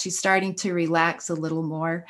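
ordinary talk

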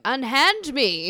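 slow, lightly theatrical Shakespearean actor voice; a hint of a grand stage actor, drawn out; Patrick Stewart. Teasing about the villain, mock theatrical plea. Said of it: "Like Patrick Stewart".

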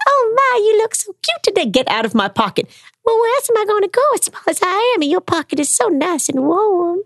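very high squeaky little creature voice; teeny tiny voice. Shown as a demonstration. Storyteller performing a tiny creature for listeners, very high and squeaky. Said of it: "Little teeny tiny voice".

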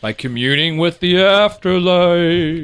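spooky voice. Pretend. Joking spooky mystic line.

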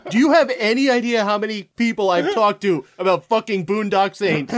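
loud, high-pitched venting; exasperated rant. Real, not acted. Boston college veteran fed up with Boondock Saints talk, loud ranting.

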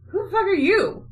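high-pitched incredulous demand; indignant challenge. Retold or imitated. Austria confronting the bastard, indignant.

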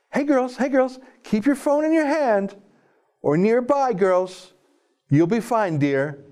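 very high, patronizing mock-advisor voice; mock police advice. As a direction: parody reassuring the girls in a high, sugary voice. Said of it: "Police have offered these safety tips".

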